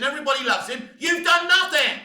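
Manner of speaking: loud, high accusing yell; angry shout. Real, not acted. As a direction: blast it at them. Ranting at producers.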